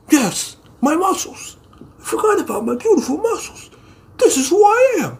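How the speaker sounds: very high, mock-weepy voice; hammed-up tearful. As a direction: wail it in a squeaky, overcome falsetto. Acted muscle man's tearful realization.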